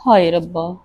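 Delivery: soft hopeless oh God; despairing sigh. Acted. Alone after being blamed.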